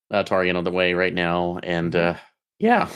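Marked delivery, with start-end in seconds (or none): none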